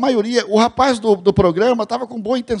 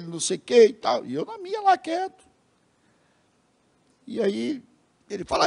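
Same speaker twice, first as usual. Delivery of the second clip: usual speaking voice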